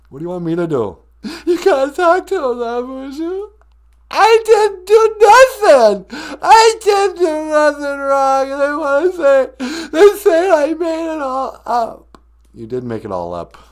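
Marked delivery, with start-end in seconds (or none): 1.5-12.2 high, loud, hammed-up crying wail; mock Jussie Smollett. Prank caller sobbing to the radio host, high mock wail. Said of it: "Jussie Smollett is on the telephone".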